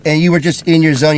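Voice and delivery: normal talking voice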